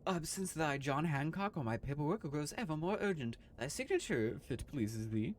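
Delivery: lightly voiced old-fashioned landlady, formal; archaic landlady voice. Landlady urging her lodger to sign, lightly voiced formal. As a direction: read it like a stiff old landlady.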